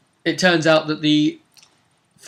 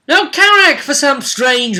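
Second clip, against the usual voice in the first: dramatic call; 11 semitones higher, 7 dB louder, slower than usual. Acted. GM dramatic address to player.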